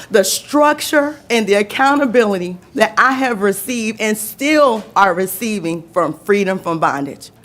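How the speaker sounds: usual speaking voice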